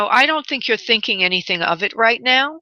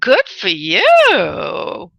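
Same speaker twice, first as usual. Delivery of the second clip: slow, stretched-out good for you; savoring each word of Good for you; drawn-out congratulation; 4 dB louder, much slower than usual. Real, not acted. Psychic congratulates caller.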